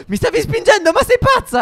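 high sing-song outraged protest. Real, not acted. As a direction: squeal it out in mock outrage. Pushed toward zombies, protesting. (protesta acuta e cantilenante)

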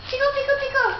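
high playful tickle voice; squeaky tickle monster chant; giggly tickle attack. Shown as a demonstration. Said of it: "so you go like this".

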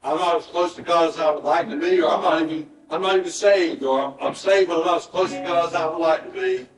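altar call preaching. Acted. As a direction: plead with the congregation to respond. Pastor leads an altar call.

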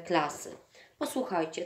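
ordinary delivery

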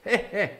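just chuckle it; plain heh heh. Shown as a demonstration. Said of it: "That's how it's written".

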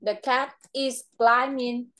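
put-on slow, clear model sentence for the child to repeat; patient teacher prompting a child, slowly; slow model phrase. Shown as a demonstration. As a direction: spell it out slowly so the pupil can follow. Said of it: "Leo lên cây đi".

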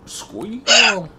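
high, loud, creepy command; menacing villain shout. Pretend. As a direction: bark it like a sadistic villain. Menacing movie-villain quote.

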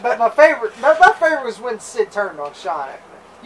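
ordinary delivery

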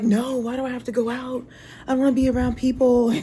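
imitating daughter. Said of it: "She's like".

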